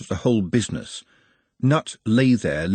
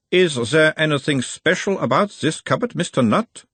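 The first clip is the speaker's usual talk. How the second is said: put-on a mild Uberwaldian accent, the higher voice of a gentle examiner; 7 semitones higher than usual. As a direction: question him in a light Uberwald accent, calming the patient. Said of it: "talking like someone from Uberwald".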